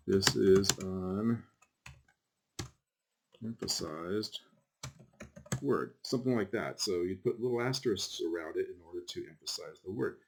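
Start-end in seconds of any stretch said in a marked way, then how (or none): 0.1-5.9 slow, halting, with long gaps between words; typing aloud. Shown as a demonstration. Teacher typing a demo sentence for the class, slow and halting. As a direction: say each bit as you type it, pausing.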